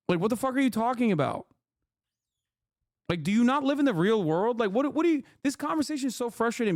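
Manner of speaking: fed-up disbelief at chat; exasperated rant. Real, not acted. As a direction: let the frustration push the pitch up.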